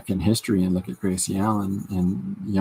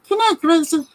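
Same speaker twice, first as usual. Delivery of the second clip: high, louder Gracie sign-off; mock sign-off; 6 dB louder than usual. Quoting the Burns and Allen sign-off in a high voice. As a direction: sing it out as the old show tag.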